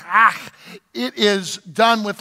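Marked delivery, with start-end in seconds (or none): 0.1-0.5 "ah" punched out with a guttural ch; forceful "ah" with a throaty Hebrew ending; emphatic Hebrew pronunciation. Shown as a demonstration. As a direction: throw out "ah" like an exclamation, scraping the end. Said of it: "like, an exclamation point".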